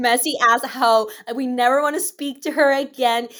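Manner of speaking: loud, punchy pile-on insult; mock outrage. Piling on the girl who can't flip her hair, mock outrage. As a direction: throw it out loudly in pretend disgust.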